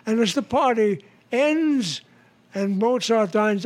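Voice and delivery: ordinary talk, nothing marked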